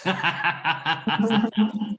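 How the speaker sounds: brief real laugh; light laugh. Real, not acted. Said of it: "laughing in the lesson".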